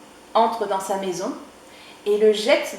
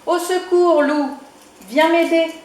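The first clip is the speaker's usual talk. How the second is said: high, loud cry to the wolf; calling out; 7 semitones higher, 6 dB louder than usual. Acted. Chick calling the wolf to come help him. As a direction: shout for rescue.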